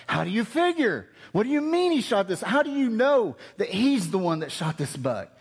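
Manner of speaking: high challenging questions; demanding hunters. Retold or imitated. Hunters challenging Cheryl, high and demanding. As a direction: press him for proof, pitched high. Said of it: "hunters question Cheryl".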